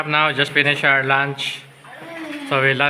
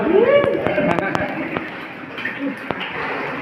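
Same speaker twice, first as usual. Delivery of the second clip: breathy high laugh. Real, not acted. Laughing at sleepy companion.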